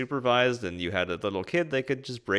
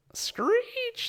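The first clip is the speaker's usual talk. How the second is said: very high mock screech; shrieky high-pitched bird imitation; imitated bird screech. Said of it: "There's this screeching bird again".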